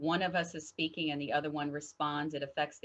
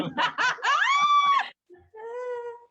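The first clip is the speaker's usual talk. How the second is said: loud, high burst of laughter; big laugh; 7 dB louder than usual. Real, not acted. Wife joking about her off-camera husband, laughing loudly. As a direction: let out a high, shrieking laugh. Laughs at own union joke.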